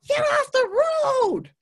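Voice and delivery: high, loud mock shout of warning; hammed-up yell. Onlooker to tarantula crossing back over the road, hammed-up yell. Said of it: "And you're like".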